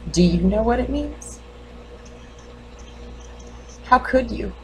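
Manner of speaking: restrained questioning, long pauses between lines; lightly played grieving mother, guarded and probing; wounded mother challenging the doctor. Mother asks doctor if she looks crazy, quietly challenging. Acts monologue of bereaved mother.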